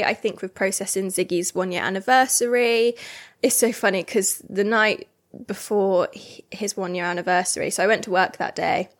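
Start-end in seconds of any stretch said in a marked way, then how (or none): none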